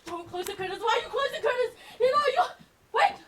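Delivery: high, loud, frantic cries; panicked protest. Acted. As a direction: plead in rising panic. Vision of room is shut out.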